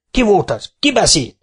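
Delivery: high, loud angry demand; snapping at the crowd. Acted. Micu snapping at the crowd, high angry demand. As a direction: bark it at the crowd. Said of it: "rivalt a tömegre".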